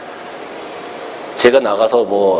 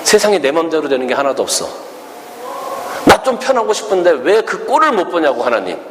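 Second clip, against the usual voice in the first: angry grumbling; 7 semitones higher than usual. Acted. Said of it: "막 화를 냅니다".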